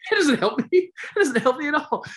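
high, loud laughing protest; real laughing squeal. Real, not acted. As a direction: laugh through the words. Laughing at own gaffe.

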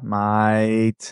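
low, drawn-out Aussie mate; Australian accent. Retold or imitated. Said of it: "like my Australian accent".

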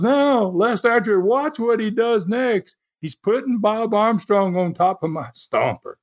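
high-pitched mock outcry; Don Carson protesting. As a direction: imitate an indignant wrestler, high and stretched. Retold protesting wrestler, same screaming voice.

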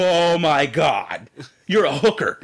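slow, laughing through the words; laughing disbelief at the truth; laughing realization. Retold or imitated. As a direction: crack up as it dawns on you. Realizing date's job, cracking up.

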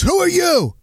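imitating Ditka challenging a reporter; gruff coach. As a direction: bark out who are you, mock Ditka. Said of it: "and he goes".

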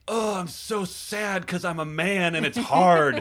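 high, slow, whiny mock lament; mock whiny man. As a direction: whine it like a self-pitying hero. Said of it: "it could have been like".

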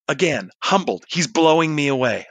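ordinary talk

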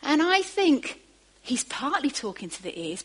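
ordinary talk

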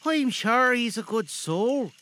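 high, unhurried wife's voice; approving wife. Acted. Wife agreeing about the nephew, high and approving. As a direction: say it high and slow, with conviction.

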